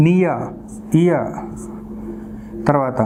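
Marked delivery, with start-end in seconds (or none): 0.1-1.3 "నియర్" as "nee-ya", "ఇయర్" as "ee-ya"; "నియర్, ఇయర్" ending on an open vowel, no R; silent final R. Shown as a demonstration. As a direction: model "నియర్, ఇయర్" separately, dropping the R. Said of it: "ఆర్ sound అనేది మనం produce చేయకూడదు".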